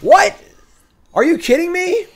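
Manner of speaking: very high, loud what; playful outraged wail; shrieking disbelief. Real, not acted. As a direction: squeal it in betrayed shock. Streamer outraged at opponent's card.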